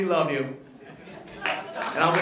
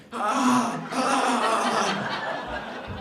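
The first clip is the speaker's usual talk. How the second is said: higher, garbled "ah" noises; imitated wordless straining; 5 semitones higher, much slower than usual. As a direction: mimic voiceless attempts at talking. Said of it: "I don't understand a word he's saying".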